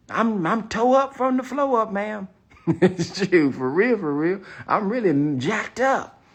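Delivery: loud and high, amused at himself; excited, laughing admission. Real, not acted. Laughing to the audience about really struggling. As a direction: confess it loudly through laughter.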